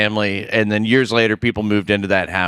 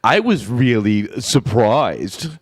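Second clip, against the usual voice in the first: put-on slow old-school New York accent; slower than usual. Shown as a demonstration. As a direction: drawl it like an average New Yorker. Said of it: "impersonating there".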